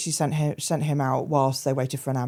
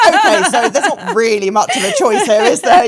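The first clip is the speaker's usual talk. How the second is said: laughing resignation; 9 semitones higher, 10 dB louder than usual. Real, not acted. Laughing at forced hospital choice.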